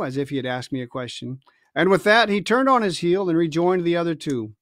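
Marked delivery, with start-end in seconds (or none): none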